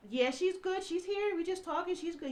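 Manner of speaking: high-pitched imitation of a reassuring friend; high, quick-reassurance voice, acted out; placating. Friend placating man at the door.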